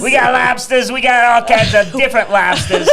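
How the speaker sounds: bad Boston accent, shouted high and excited; hammed-up Northeast accent, yelling it out. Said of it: "I was doing a northeastern accent of America".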